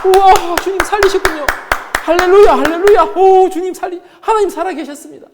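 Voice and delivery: high, loud crowd jubilation; excited cheering. Retold or imitated. Onlookers praising God after the girl rises, excited shouting.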